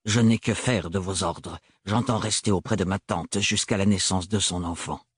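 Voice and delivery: unmoved defiance; cold Thorn. Acted. Thorn refuse l'ordre.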